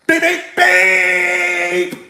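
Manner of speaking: slow, drawn-out beep beep beep like a car lock; imitated car horn beeps. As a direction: mimic the lady's car beeping at him. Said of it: "she like".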